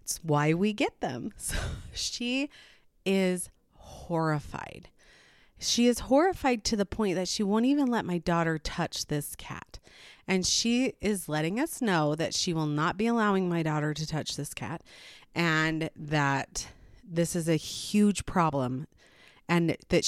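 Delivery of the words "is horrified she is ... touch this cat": ordinary talk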